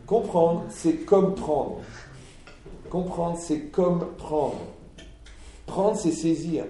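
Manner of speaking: very slow, each word weighed; slow emphatic. Shown as a demonstration. Preacher spelling out a word link, very slow. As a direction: drag it out to teach.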